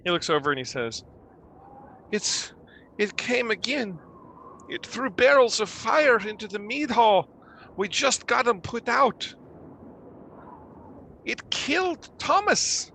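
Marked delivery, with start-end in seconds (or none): 2.1-12.9 high-pitched, fearful account; frightened villager reporting horror; distraught survivor. Acted. Villager telling the party about the night attack, distraught.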